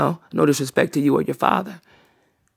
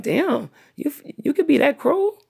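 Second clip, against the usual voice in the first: imagined disbelief; 9 semitones higher, slower than usual. Retold or imitated. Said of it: "he probably feel like".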